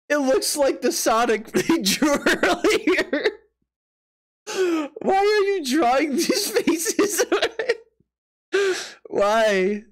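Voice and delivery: high, stretched words breaking into laughter; laughing disbelief. Real, not acted. Streamer ribbing viewers over their drawings, laughing in disbelief. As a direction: crack up while protesting.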